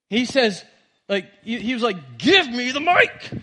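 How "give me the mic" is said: high, loud, drawn-out acted shout; imitated outburst at full volume; desperate demand. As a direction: demand it as a frantic parent, stretching the words. Acts desperate father demanding mic.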